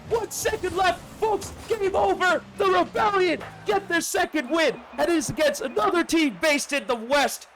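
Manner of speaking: fast, high, energetic commentary; live play-by-play. Real, not acted. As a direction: call the match live.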